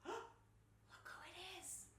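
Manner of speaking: hushed aside to the listeners; soft, breath-only whisper; secretive whisper. Pretend. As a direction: whisper it as if the bear might hear.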